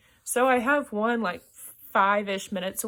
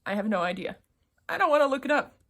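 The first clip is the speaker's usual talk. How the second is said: usual speaking voice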